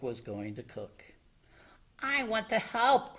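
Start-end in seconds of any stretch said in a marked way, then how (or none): 2.0-3.2 high, keen plea; eager cry. A narrator's reading. Froggy offers Grandma help cooking, high eager cry. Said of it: "cried Froggy".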